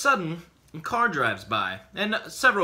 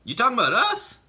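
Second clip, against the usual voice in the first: higher, louder voice of a stranger; stranger's voice; 10 semitones higher, 5 dB louder, much faster than usual. Retold or imitated. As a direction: call it out from the dark. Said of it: "we hear a voice to our right saying".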